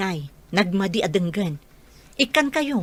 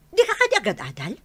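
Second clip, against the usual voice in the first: ordinary talk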